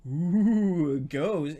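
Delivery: high, drawn-out mock spooky wail; mock ghost. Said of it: "not like".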